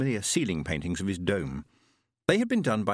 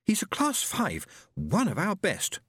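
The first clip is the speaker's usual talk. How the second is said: high, slow proud sister; 10 semitones higher, slower than usual. Acted. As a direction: show him off with pride. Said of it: "she said proudly".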